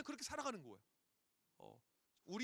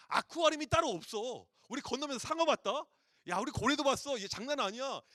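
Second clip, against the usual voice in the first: much louder, eager storytelling; excited boasting; 3 semitones higher, 10 dB louder than usual. Retold or imitated. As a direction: brag about the sea crossing. Parents boast about Red Sea crossing.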